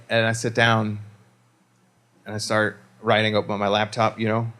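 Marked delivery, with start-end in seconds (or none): none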